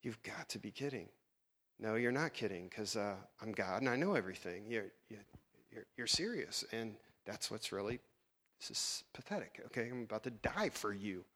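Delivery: soft, low, head-shaking disbelief; weary muttering. Retold or imitated. Jesus quietly shaking his head at the disciples, low and soft. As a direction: mutter it to yourself in tired disbelief. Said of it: "shaking his head like".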